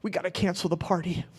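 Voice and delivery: softly voiced upset son; quiet tearful son. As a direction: hush it like a kid pulling his dad aside. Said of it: "He goes".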